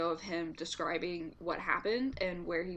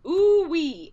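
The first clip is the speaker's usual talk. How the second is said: excited; 8 semitones higher, 12 dB louder than usual. Retold or imitated. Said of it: "He said, quote".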